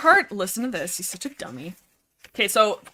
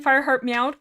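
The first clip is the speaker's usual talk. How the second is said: normal talking voice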